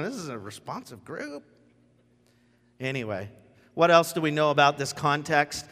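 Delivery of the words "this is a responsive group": dry aside. Retold or imitated. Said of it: "he's just going".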